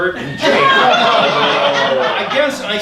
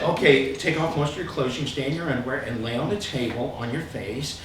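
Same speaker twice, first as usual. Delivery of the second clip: soft-spoken healer; 7 semitones lower, 9 dB quieter than usual. Retold or imitated. Healer Sharon quoted, soft low voice.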